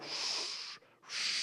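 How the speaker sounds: two hushed swooshing sounds; fishtailing whoosh. Retold or imitated. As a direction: imitate the plane swerving through the air. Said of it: "so we're like".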